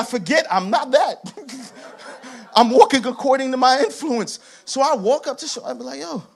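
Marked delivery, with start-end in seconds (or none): none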